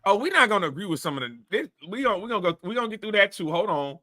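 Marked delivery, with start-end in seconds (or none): none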